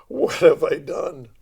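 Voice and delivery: slow, loud, horrified question; mock dismay. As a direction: drag it out in regret. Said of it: "in their eyes like".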